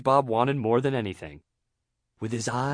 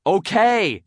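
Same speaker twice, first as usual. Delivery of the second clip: raised, loud, exasperated interjection; annoyed; 8 semitones higher, 7 dB louder than usual. A narrator's reading. Teacher to overeager student, annoyed. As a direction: cut in with irritation. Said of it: "said an annoyed Missus Puff".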